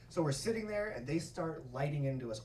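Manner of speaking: usual speaking voice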